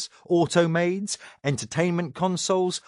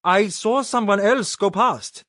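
put-on nervous young man; 6 semitones higher, 3 dB louder than usual. A narrator's reading. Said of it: "Robert said".

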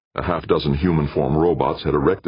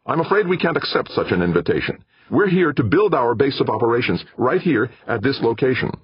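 snapping, higher and louder; sharp snapping refusal; 11 semitones higher, 3 dB louder than usual. Acted. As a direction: snap back a firm no. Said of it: "Meek snapped".

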